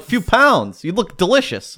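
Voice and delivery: high, loud disbelieving retort; incredulous. Retold or imitated. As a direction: act shocked at the answer. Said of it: "I'm like".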